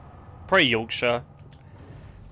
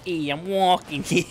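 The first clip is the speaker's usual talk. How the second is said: put-on higher-pitched New York accent; 5 semitones higher than usual. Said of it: "What, from New York?".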